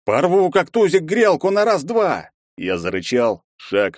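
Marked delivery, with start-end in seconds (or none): 0.0-2.3 high, loud boastful battle cry; bravado shout. Acted. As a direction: pump yourself up for the fight. Герой распаляет себя. (хвастливый боевой крик)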